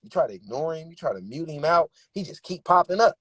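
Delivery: usual speaking voice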